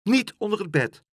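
high, loud cry; little girl's high-pitched protest; panicked child. A narrator's reading. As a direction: plead in sudden alarm.

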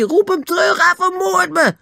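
high, panicked shriek; terrified scream for help. Acted. Lou screams at Nobby to call off the ape. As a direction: scream for your life. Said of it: "Lou gilde naar Nobby".

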